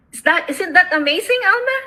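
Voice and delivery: thrilled, asking the friend to share it; rising excited question. Real, not acted. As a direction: pitch jumps way up on amazing and Alma. Excited news to her friend.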